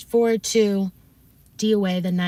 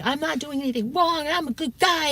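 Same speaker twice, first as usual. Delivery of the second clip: mock mad; 8 semitones higher than usual. Streamer protests his innocence on the phone, mock mad. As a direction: imitate his indignant self-defence, pitched way up. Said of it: "he got really mad".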